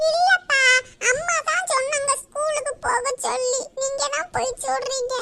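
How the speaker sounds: high-voiced tearful protest; crying child. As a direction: complain to dad in a child's whine. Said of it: "இதுக்கு போய் யாராவது அழுவாங்களா".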